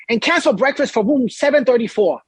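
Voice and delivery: high, insistent retold yell; imitated yelling guest. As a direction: imitate a guest announcing his demand to everyone. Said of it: "He's yelling this".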